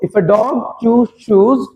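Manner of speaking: very slow and careful; teacher asking students to listen closely, slowly; slow demo. Shown as a demonstration. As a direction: say it so students can follow. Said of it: "ध्यान से सुनना एक बार मेरे को".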